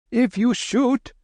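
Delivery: high, slow, pleading shout; high drawn-out Babu cry. Acted. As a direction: shout a warning up the hill. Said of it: "shouted Hari".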